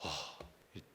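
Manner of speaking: ordinary talk, nothing marked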